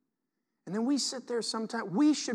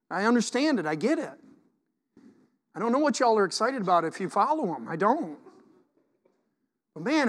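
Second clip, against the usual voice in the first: ordinary talk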